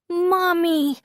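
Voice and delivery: put-on very high demanding child's voice; outraged little girl. A narrator's reading. Vomiting daughter demands mom's attention, outraged child's voice. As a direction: summon mom in an indignant squeal. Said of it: "outraged at the indignity".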